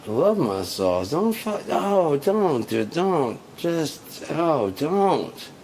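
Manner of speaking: loud high-pitched angry pleading; furious outburst. Real, not acted. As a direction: rage at the memory. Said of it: "Still mad about that".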